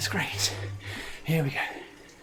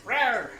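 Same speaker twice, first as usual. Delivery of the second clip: playful roar; 9 semitones higher than usual. Pretend. Mock tiger roar at Max.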